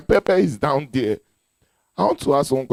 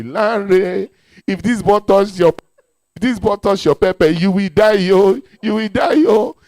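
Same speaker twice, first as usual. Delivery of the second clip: spirit warning; 3 semitones higher, 7 dB louder than usual. Retold or imitated. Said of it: "My spirit was not advising my body".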